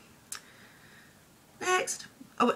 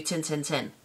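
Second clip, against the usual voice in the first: Lincolnshire dialect. Retold or imitated. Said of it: "if somebody turns around and says to you".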